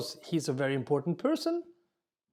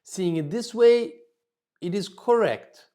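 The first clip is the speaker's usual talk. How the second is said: higher and louder, emphatic concession; diplomatic agreement; 4 semitones higher, 6 dB louder than usual. Shown as a demonstration. Teacher showing how to concede then contradict, higher and louder. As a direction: agree loudly before you disagree. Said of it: "and then you say the opposite".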